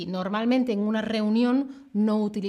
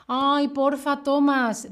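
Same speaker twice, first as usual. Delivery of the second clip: mock begging, a little higher and louder; playful pleading; 4 semitones higher, 4 dB louder, slower than usual. Shown as a demonstration. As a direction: coax someone with a put-on plea. Acts out pleading with porfa.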